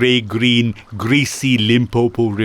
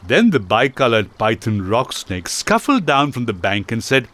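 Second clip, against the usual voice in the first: usual speaking voice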